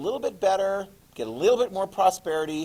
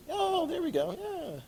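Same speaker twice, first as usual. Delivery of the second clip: very high, soft little voice; high mock discovery; 14 semitones higher, 8 dB quieter, slower than usual. Teacher playing students spotting the word, high and soft. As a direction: squeak it softly in mock realisation.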